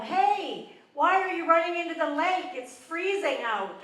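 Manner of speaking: high mock yell across the boardwalk. Comic yelling at cold plungers from the boardwalk, mock yell. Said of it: "And I yelled".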